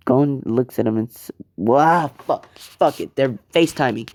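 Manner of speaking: ordinary delivery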